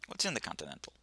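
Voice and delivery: low, quiet question; low-key, soft query; first asking. Acted. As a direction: ask it softly, almost to yourself. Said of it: "I said".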